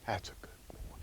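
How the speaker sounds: soft unvoiced praise to the dog; whispered praise. Real, not acted. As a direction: whisper it close to his ear.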